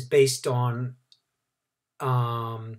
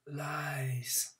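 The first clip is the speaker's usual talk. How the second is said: quiet whisper; 2 semitones higher, 11 dB quieter than usual. A narrator's reading. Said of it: "He didn't shout".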